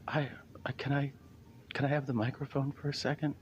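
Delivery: nervous breathy plea for the mic; timid older woman. Retold or imitated. Comic imitating shell-shocked organizer asking for the mic, high and soft. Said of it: "She's a little older".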